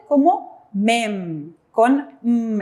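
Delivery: "mem" drawn out very slowly, the final m held with lips shut; lips closed on M. Shown as a demonstration. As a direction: hum the closing "mm" of "mem" slowly. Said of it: "el sonido cerrando los labios de M".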